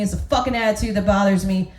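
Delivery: ordinary talk, nothing marked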